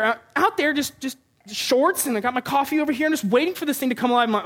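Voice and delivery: ordinary talk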